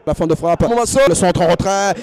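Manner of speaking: normal talking voice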